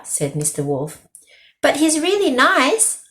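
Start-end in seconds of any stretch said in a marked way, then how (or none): 1.6-3.0 higher, pleading protest; arguing. A narrator's reading. Girl arguing with the wolf about Rabbit. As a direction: insist he is nice.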